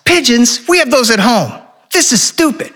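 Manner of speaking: put-on high-pitched childish whine; whining kid. Bored son on a whale watch, whining. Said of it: "Henry whines".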